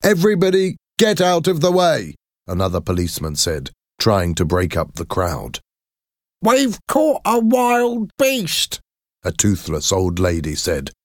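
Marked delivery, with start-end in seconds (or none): 0.0-2.1 much higher barked order; commanding order. A narrator's reading. Policeman breaks up crowd. 6.4-8.8 put-on slow, much higher cackling old woman; old lady boasting to the police; toothless old crone. A narrator's reading.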